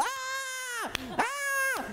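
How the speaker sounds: high mock scream of pain, like an animal. Grandpa leaps from bed with burned hand, high animal scream. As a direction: yelp it out, burned and startled. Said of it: "he was screaming like an animal".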